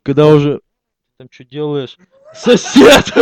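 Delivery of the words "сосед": excited exclaim. Acted. Sudden exclaimed naming.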